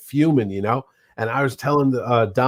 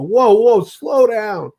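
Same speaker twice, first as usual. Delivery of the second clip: acted high, louder interjection; alarmed restraint; 9 semitones higher, 5 dB louder, much slower than usual. Husband reining in his angry wife, alarmed. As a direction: break in high to halt the fight.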